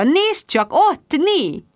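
high-pitched, excited pointing-out; joyful, eager cry; delighted. A narrator's reading. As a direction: exclaim it gleefully up high. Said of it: "örvendezett Magda".

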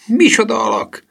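put-on very high-pitched boyish cry; high, loud exclamation; indignant exclamation. A narrator's reading. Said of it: "kiáltotta Gustav".

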